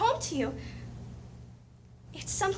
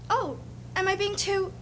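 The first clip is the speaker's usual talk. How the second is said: ordinary delivery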